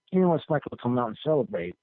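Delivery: ordinary talk, nothing marked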